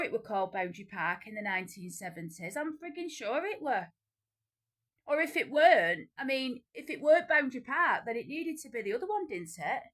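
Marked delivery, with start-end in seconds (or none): 2.6-9.3 indignant. Real, not acted. Indignant insistence.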